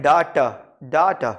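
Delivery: डाटा as DAA-ta; second pronunciation. Shown as a demonstration. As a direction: open long "aa" opening डाटा, falling "ta". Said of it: "second syllabus में थोड़ा सा stress".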